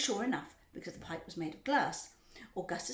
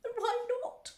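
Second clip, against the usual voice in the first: very high, louder demand; shrieking; 5 dB louder than usual. A narrator's reading. Mother challenging the factory owner, shrieking. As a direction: shriek the question. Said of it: "shrieked Missus Gloop".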